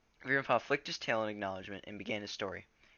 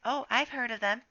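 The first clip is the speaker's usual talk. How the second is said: put-on young apprentice voice; 5 dB louder than usual. A narrator's reading. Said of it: "mewed the tabby".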